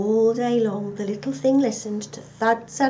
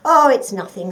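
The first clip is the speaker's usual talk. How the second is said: dismissive mover; 2 semitones higher, 10 dB louder than usual. Acted. Second mover dismisses the thing.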